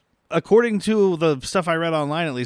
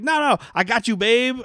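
excited fan; 7 semitones higher than usual. Retold or imitated. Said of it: "he's like".

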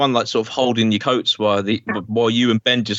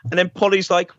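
ordinary talk

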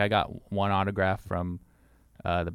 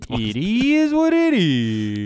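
slow, higher, louder drawl; slow trailer drawl; 8 semitones higher, 7 dB louder, much slower than usual. Shown as a demonstration. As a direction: stretch it out like a trailer. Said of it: "I said, "How you doing?".

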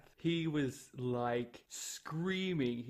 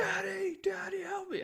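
put-on very high wailing child's cry; toddler wailing. Son crying for dad's help, high wailing child voice. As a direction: wail for daddy like a small boy. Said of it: "He was just like".

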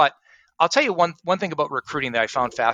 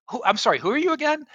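high-pitched, mock-puzzled double take; baffled candidate; 12 semitones higher than usual. Candidate asking a cold-calling recruiter who he is, baffled. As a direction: imitate someone caught off guard, voice jumping up high.

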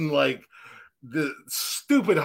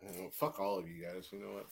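mock sulk; 7 semitones lower, 10 dB quieter than usual. Mock sulking after being teased.

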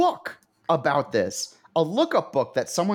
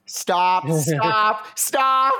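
pleading stop; 5 semitones higher, 4 dB louder, much slower than usual. Real, not acted. Begging friend to stop.

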